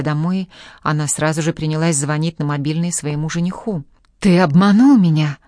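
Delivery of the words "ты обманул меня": loud frantic accusation; hysterical shouting. A narrator's reading. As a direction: yell it, out of control with rage. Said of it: "истерично кричала она".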